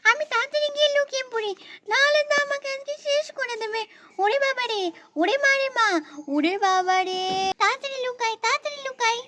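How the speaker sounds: put-on high, fast little-kid chatter; child squealing in alarm; panicking child. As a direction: gabble in mock fright as you flee. Child panics, runs to hide.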